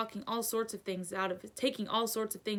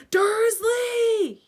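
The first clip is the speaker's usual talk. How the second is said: high, loud, drawn-out shout; explosive full-voiced yell; boomed; 11 dB louder than usual. A narrator's reading. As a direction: bellow the name in fury. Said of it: "he boomed".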